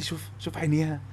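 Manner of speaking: soft, dreamy admiring murmur; hushed admiring. Retold or imitated. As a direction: gaze at her picture and murmur in awe. صاحبه يتغزل في صورة البنت. (همس حالم معجب)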